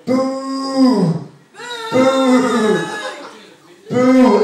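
mock heckler booing, building up; angry booing. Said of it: "She starts going".

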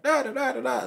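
mock protester ranting. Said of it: "be like".

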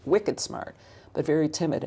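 ordinary talk

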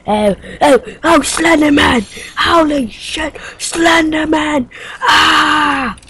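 loud, high shouts of alarm; startled yelling, ending in a scream; panicked scream. Pretend. Startled by Slenderman in game.

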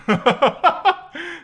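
high, loud laugh. Real, not acted. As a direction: burst into laughter. Laughs at disappointed man.

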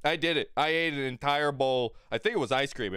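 normal talking voice

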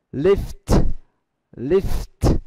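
"lift" with a clean final t; final t sound. Shown as a demonstration. Teacher modelling "lift" for students, final t. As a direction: model "lift" slowly for the class. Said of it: "một ví dụ khác đó là lift".

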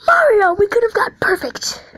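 high, loud wail of complaint; dismayed yell. Real, not acted. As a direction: scold the game hero in loud dismay. Complains loudly to game character.

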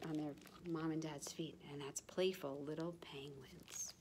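soft unvoiced whisper to close the book; bedtime whisper. A narrator's reading. Ending the bedtime story for children, whispered. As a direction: whisper it so the little ones drift off.

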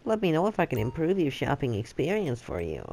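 lightly mimicked shop clerk, sing-song; mocking the clerk. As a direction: parrot the clerk's customer-service line. Parrots the shop clerk's line.

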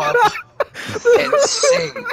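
high, loud, laughing hard; squeaking words out through laughter. Real, not acted. Laughing fit mid explanation.

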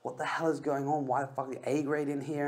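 what on earth is happening here; angry. Retold or imitated. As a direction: vent the confusion and anger. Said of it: "I literally was just so angry".

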